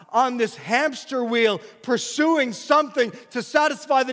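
impassioned preaching peak; full-force preaching. Acted. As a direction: drive it home from the pulpit.

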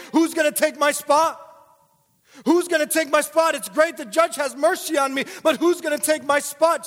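very high, loud, pleading cry; desperate plea. Acted. Condemned person crying out in court, desperate and loud. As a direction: cry out like the condemned begging for help. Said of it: "voicing the condemned person's plea".

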